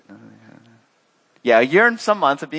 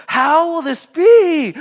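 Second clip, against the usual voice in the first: very high, loud, drawn-out question; hammed-up bewilderment; mock incredulous outburst; 8 dB louder, much slower than usual. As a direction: blurt it in exaggerated disbelief. Mary's bewildered question, acted out.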